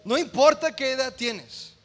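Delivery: loud, rising preacher's exhortation; full-force sermon climax; fervent preaching. Acted. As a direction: drive it home to the congregation.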